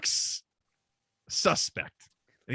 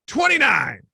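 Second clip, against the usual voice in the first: higher, louder game-show guess; guessing shout; 9 semitones higher, 5 dB louder than usual. Retold or imitated. Drunk friend guessing the woman's age, loud shout. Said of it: "He's like".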